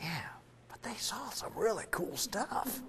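whispered private aside, slowed down; hushed confession to the congregation; whispered thought. Retold or imitated. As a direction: breathe it like a secret doubt. Privately doubting the Holy Spirit claim.

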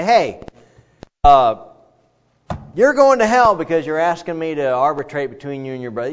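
hammed-up fire and brimstone rebuke; mock condemnation. Pastor imagining Jesus damning the man to hell, mock loud rebuke.

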